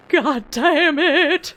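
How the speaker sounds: loud, slow drawn-out curse; startled curse. Real, not acted. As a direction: swear hard after the jump scare. Startled aftermath.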